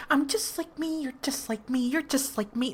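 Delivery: put-on high, softened little voice; high mocking imitation; high-pitched mock quote. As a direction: mimic someone in a high, repetitive singsong. Excitedly acted quote of someone else.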